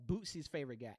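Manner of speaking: normal talking voice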